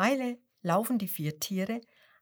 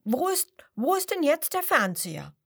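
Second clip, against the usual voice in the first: higher, disappointed call; 8 semitones higher than usual. Acted. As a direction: call out, let down. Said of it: "ruft das Schwein enttäuscht". (enttäuscht gerufen)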